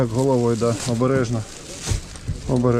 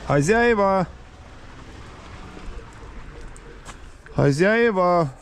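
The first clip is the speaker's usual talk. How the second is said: calling out; 6 semitones higher, slower than usual. Real, not acted. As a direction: shout for the owners of the house. Calling for owners at house.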